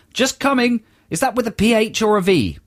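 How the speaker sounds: put-on a half-hearted toff English accent; mock posh English accent. Man in the museum shouting back in weak mock posh English. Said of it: "It's not a very good mock posh English accent".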